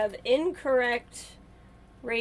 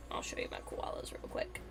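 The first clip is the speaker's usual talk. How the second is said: playful secret whisper; conspiratorial whisper to the viewers; 14 dB quieter than usual. Real, not acted. Repeats line as a whisper.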